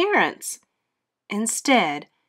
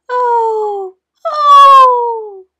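high, loud squeaking moan; a failed monster moan, all squeak; squeaky moan; 10 dB louder than usual. A narrator's reading. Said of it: "his moan was more of a squeak".

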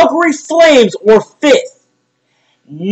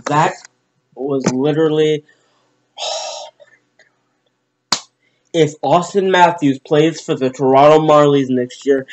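low, quiet, deflated voice; stunned; 11 semitones lower, 7 dB quieter than usual. Real, not acted. Fan absorbing the loss, stunned. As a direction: drop right down, almost out of breath on the exclamation.

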